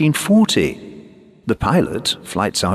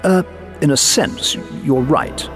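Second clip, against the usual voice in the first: halting, higher-pitched, unsure reply; faltering; 10 semitones higher than usual. A narrator's reading. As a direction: falter as you reluctantly half-agree. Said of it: "his voice faltered".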